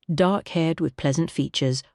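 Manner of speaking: usual speaking voice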